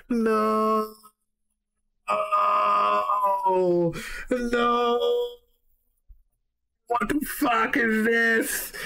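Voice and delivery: high, slow, incredulous groan; real shocked dismay; stunned disbelief. Real, not acted. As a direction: react like you can't believe it. Reacting to shocking lyric.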